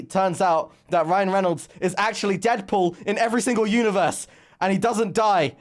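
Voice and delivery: high, rising excitement; excited reveal. Real, not acted. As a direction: blurt out the big twist, thrilled.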